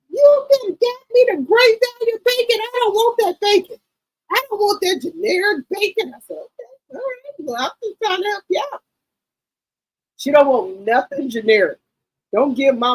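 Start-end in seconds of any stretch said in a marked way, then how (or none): 0.1-6.1 high, put-on old mother voice; mama's voice. Daughter imitating her mother's high demanding voice. Voicing her mama in a retold argument. 6.4-8.8 a much higher, softer voice; high mock-sweet reply. Daughter mimicking her own high, soft reply to mama.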